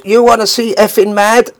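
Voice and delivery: high-pitched mock threat; retold wild challenge. Drunk Maurice before kicking the wardrobe apart, mock wild challenge.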